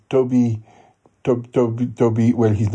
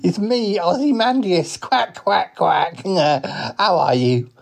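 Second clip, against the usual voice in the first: high, excitable cartoon duck greeting; high-voiced duck with quacks; talking duck; 9 semitones higher, slower than usual. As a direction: pitch it high like a chuckling duck. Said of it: "he is a duck".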